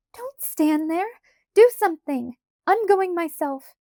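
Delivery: high, loud, frantic urging; crying out. A narrator's reading. Carrie urges Laura to do something, frantic cry. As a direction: push someone to act, near panic. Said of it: "Carrie cried".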